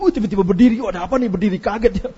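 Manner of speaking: loud startled exclaim. Retold or imitated. Guest startled when everyone suddenly stands, loud surprise. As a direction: jump at sudden standing crowd. Acting his own startled self.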